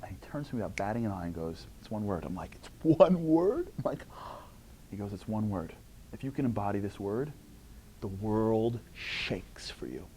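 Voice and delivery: soft, hushed, dropping to a whisper; hushed telling. Retold or imitated. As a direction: draw listeners in with a secret. Rabbi's secret revealed.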